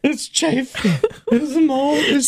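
laughing while repeating it; incredulous laughing voice. Real, not acted. Laughing at the absurd story.

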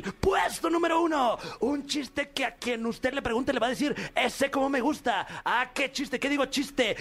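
high, hammed-up countdown announcer; podcast host parodying a grand countdown reveal; mock announcer. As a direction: hype the number one like a show host. Mock announcer hyping top joke.

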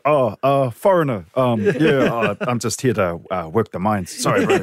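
mock New Zealand accent pretending to be a newcomer. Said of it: "Just rely on the accent".

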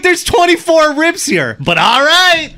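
high incredulous voice. Retold or imitated. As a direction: squeal it in shocked disbelief. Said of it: "I'm like".

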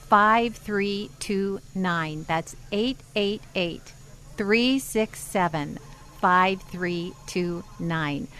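slow, carefully spaced digits; over enunciating. Shown as a demonstration. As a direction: spell out each number slowly. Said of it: "I'm gonna say it slowly".

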